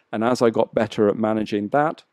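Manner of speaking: ordinary delivery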